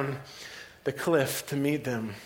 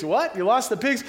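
higher pitch, rising to a disbelieving question; incredulous question; 5 semitones higher than usual. Acted. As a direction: ask it in disbelief, lifting the end. Townsperson questions the herders.

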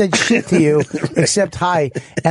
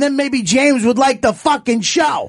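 mock rant; 8 semitones higher than usual. Ranting at the producer.

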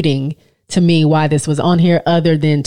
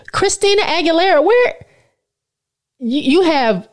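outraged disbelief; 12 semitones higher than usual. Real, not acted. Outraged at singer left off.

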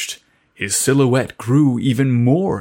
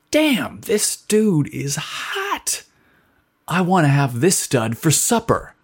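excited inner voice; 5 semitones higher than usual. A narrator's reading. Dawson's excited inner thought.

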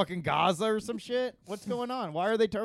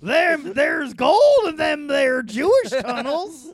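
put-on old prospector voice; 8 semitones higher, 10 dB louder, slower than usual. Prospector voice joke.

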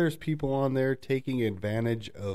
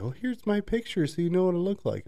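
high sing-song imitation of a catfish; mock sweet girl; 9 semitones higher than usual. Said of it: "it's like".

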